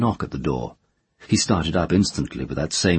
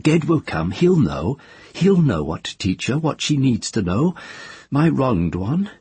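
lightly raised woman's voice; earnest woman confiding, a bit higher; Tenar's voice; 8 semitones higher than usual. A narrator's reading. Tenar's voice in the story.

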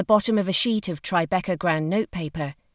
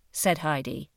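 ordinary delivery, nothing marked

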